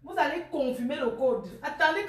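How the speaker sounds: ordinary talk, nothing marked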